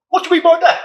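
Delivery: high, hammed-up British catchphrase; imitated British accent. Man quoting an Instagram guy, high mock British accent. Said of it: "I think in a British accent".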